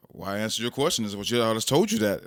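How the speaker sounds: fast, loud, defensive retort; son's snippy reply. Retold or imitated. Son answering his dad with attitude, fast and loud. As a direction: throw it back quickly and loud. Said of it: "he talks to me that way".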